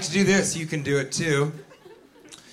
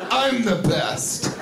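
higher, drawn-out self-praise; smug joker; 5 semitones higher, much slower than usual. Acted. Joker pleased with own joke, slow and smug. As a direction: slowly congratulate yourself.

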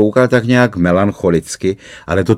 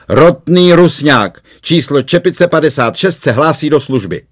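called out; 8 semitones higher, 4 dB louder than usual. A narrator's reading. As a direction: report for duty with a hearty call. (hlasité, vesele hlášení se do služby)

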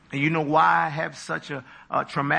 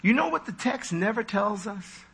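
ordinary delivery

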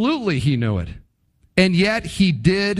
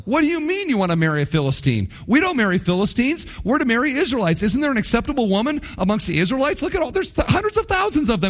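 fast, climbing, exasperated pleading; acted outburst of dismay, pitch rising higher as it goes; devastated parents' protest; 5 semitones higher, faster than usual. Devastated parents confront their son over his bride, exasperated protest. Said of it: "They are devastated by this".

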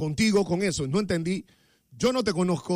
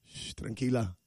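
mock soothing; 7 semitones lower, 5 dB quieter than usual. Shushing, calming himself down.

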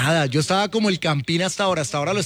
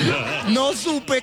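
laughing drawl; 4 semitones higher, much slower than usual. Real, not acted. Laughing confusion.

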